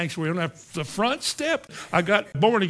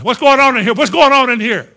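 loud, high demand; startled watchman's shout; 7 semitones higher, 14 dB louder than usual. Retold or imitated. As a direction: imitate a watchman bursting in, shouting. Night watchman bursts in on prayer.

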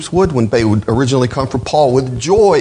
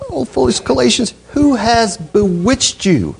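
incredulous; 8 semitones higher than usual. Retold or imitated. Paul stunned at the Galatians, high incredulous cry. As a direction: ask it in stunned disbelief, pitched high. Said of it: "he can't believe what he's heard".

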